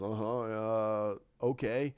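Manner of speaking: low, slow, unsure acknowledgement; doubtful retold response; acted hesitation. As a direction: draw it out low, as if taking it in. Hero hesitantly takes in the explanation.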